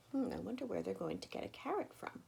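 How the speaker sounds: soft, wondering aside; hushed musing aside. A narrator's reading. Storyteller wondering softly to the children. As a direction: muse quietly to the listeners. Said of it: "storyteller aside, hushed".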